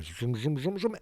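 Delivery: buzzy repeated motor noise; imitated mechanical rising. Film fan imitates Griff growing taller, mechanical whirring. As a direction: whir in pulses like a machine extending. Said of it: "and then he gets taller".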